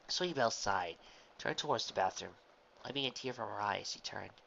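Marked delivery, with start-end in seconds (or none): none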